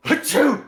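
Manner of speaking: high, loud hammed-up sneeze; a big theatrical achoo; fake sneeze. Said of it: "and he's like".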